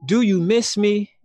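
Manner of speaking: imitated New York accent. Woman mimics co-host's music performance, imitated New York accent. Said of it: "You said it like".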